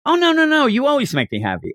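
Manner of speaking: lightly voiced mom comforting her child; reassuring mom. Podcaster voices the mother reassuring her sad daughter, high and eager. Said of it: "you want her to say".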